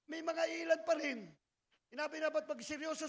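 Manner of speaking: ordinary talk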